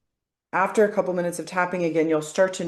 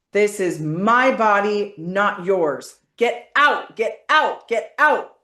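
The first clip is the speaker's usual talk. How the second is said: loud, higher, slow and forceful; powerful shout; 4 semitones higher, 5 dB louder, slower than usual. Shown as a demonstration. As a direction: claim your body back. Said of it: "really powerful statements".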